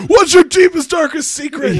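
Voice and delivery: loud, high grilling voice; mock interrogator. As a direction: fire the question like a cop.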